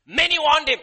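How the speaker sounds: loud, high, full force; preaching shout. Real, not acted. Preacher shouting to his congregation, loud and high. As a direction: shout it to the crowd.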